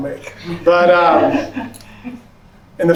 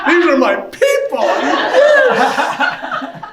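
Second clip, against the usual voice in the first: much higher, laughing cheer; triumphant, delighted exclamation; soaring, laughing "yes"; joyful; 12 semitones higher, much slower than usual. Real, not acted. Joyful at finding family.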